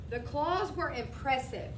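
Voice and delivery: ordinary delivery, nothing marked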